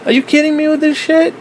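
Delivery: very high, exasperated disbelief; high-pitched are-you-kidding-me; incredulous outrage. Retold or imitated. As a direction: rant in shocked disbelief. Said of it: "And I'm like".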